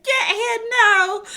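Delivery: high, loud, crying shout; desperate crying yell. Retold or imitated. Woman in labour crying out at her husband, high desperate yell. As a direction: beg through tears and pain.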